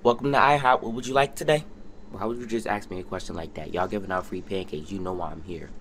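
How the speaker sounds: low deadpan voice. Pretend. Skit voice, low and soft.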